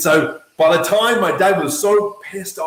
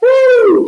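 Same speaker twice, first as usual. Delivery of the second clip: hype whoop; 9 dB louder than usual. Retold or imitated. Psyching himself up.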